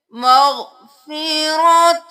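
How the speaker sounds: slow, sung tajweed recitation, high and full; melodic Quran recitation. Shown as a demonstration. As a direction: chant each word long and ringing. (تجوید کے ساتھ ترتیل)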